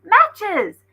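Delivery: high, loud triumphant shout; excited cry. A narrator's reading. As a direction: shout the find with glee. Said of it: "Brian cried".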